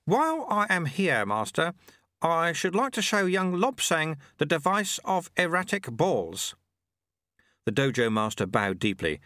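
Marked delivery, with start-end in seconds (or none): 0.1-6.6 put-on high, chirpy old man's voice; amused little old man teasing; old sweeper. A narrator's reading. Old sweeper gently mocks the shamed challenger, high and chirpy.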